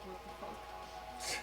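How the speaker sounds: very slow, whispered disbelief; stunned whisper. Acted. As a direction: breathe it out, dumbfounded. Sees winged cat as tiger.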